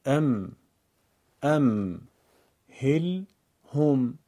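ام، هل، هم as separate syllables with clear pauses. Shown as a demonstration. Teacher demonstrates simple reading to learners: ام، هل، هم in separate pieces. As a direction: break the word into closed syllables, one at a time.

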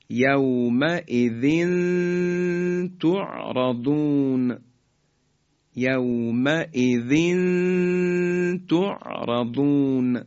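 یومئذ تعرضون slow, tanween nasalised before ت; slow recitation of یومئذ تعرضون; tanween ikhfa. Shown as a demonstration. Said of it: "تنوین رسید به طی دو نقطهٔ".

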